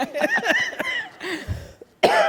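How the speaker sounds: very high, loud real laugh; high burst of laughter. Real, not acted. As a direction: let a shrieking laugh burst out. Laughing about strange employer.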